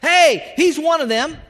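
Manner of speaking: high, loud alarm call; shouted accusation. Acted. Bystander on the porch exposing Peter, shouting high and loud. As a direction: call him out for everyone to hear.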